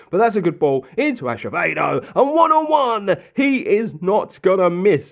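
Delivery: rising to a loud, high, stretched call; commentator's excited shout on the breakaway; excited match commentary. Real, not acted. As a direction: call the goal chance as it happens. Live call of a scoring chance.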